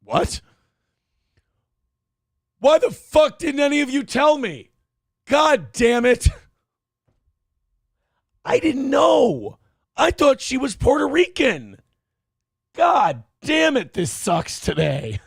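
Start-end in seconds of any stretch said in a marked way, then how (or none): 2.6-13.9 mock outraged shout. Streamer yells at his chat in hammed-up mock outrage. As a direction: ham up the betrayal, yelling at the chat.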